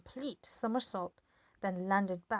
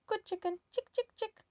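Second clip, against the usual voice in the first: coaxing hen; 14 semitones higher than usual. A narrator's reading.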